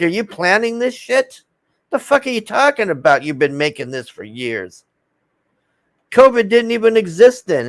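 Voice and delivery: mock confrontation. What he would tell the CDC lady, indignant challenge. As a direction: pretend to grill her. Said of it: "I'd be like".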